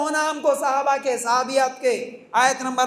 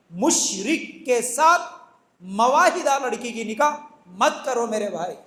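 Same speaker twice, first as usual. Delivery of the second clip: ordinary delivery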